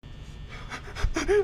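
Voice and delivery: high breathy nervous laugh. Real, not acted. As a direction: laugh out the tension. Nervous laugh after scare.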